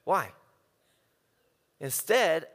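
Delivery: normal talking voice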